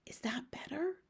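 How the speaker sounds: soft whispered question; careful whisper. A narrator's reading. Sophie checks her volume with the giant, careful whisper. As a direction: whisper it gingerly. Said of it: "Sophie whispered".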